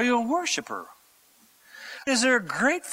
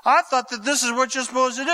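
usual speaking voice

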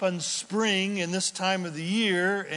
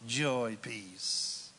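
usual speaking voice

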